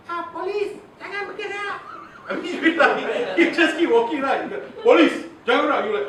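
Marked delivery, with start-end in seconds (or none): 0.1-1.8 squeaky-high, feeble police order; high-pitched voice. Shown as a demonstration. Trainer mocking an officer ordering someone to freeze, high-pitched. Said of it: "if you put a high pitch voice". 4.9-5.8 forceful, raised police order; loud command. Shown as a demonstration. Officer ordering someone to freeze, loud and commanding.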